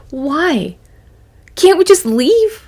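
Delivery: put-on high, drawn-out childish complaint; stretched, high-pitched plea; pleading kid. Child pleading to leave restaurant.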